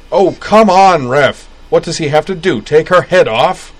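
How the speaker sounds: yelling at the referee, outraged; loud, high protest at the ref; angry fan shout. Acted.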